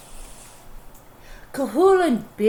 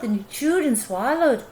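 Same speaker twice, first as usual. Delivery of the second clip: usual speaking voice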